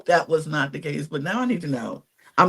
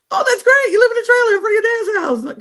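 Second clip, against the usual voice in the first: much higher, fast, over-the-top cheery praise; mock gushing Kyle. Telling her co-host how Kyle reacted, in a high gushing mock voice. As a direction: gush it like a clueless rich guy being upbeat.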